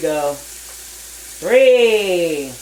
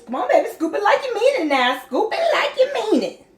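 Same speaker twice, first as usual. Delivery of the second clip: excited cheering; 10 semitones higher than usual. Real, not acted. Mom cheering child on while scooping.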